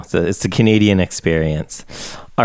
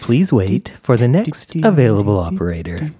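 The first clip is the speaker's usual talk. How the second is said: phone menu voice; 5 semitones higher than usual. Pretend. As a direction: read it like a recorded phone line. Podcast intro mock hold message.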